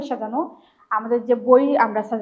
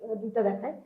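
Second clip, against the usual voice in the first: usual speaking voice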